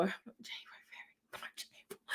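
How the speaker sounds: counting the months in a whisper. Real, not acted. Counting months in a whisper while reading the date.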